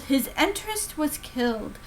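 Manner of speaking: usual speaking voice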